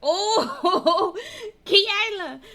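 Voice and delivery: laughing through a friend's name; real laughter. Real, not acted. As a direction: laugh out loud at a friend's comment. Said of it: "I'm laughing because Kiala just".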